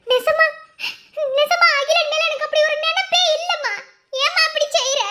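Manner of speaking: high, loud sobbing plea to her mother; desperate high wailing plea; tearful begging. Acted. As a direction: cry out and beg through the tears. Daughter begs mother against marriage.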